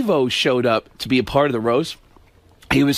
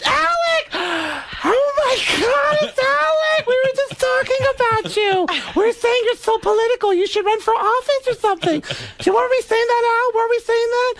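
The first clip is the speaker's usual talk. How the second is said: much higher, gushing clueless woman's voice; Beverly D'Angelo impression. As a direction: squeal it like a starstruck, clueless actress. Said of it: "Beverly D'Angelo, completely clueless, gets off the elevator and goes".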